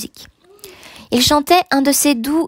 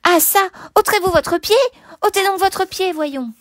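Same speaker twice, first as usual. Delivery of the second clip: put-on high, almost menacing little boy; irritated child; 8 semitones higher than usual. A narrator's reading. Small boy ordering the man to move, irritated. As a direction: scold indignantly in a high child's voice. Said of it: "irrité, quoique tout petit".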